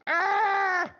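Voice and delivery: very high, loud shocked scream. Acted. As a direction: scream in horror. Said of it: "and I screamed together".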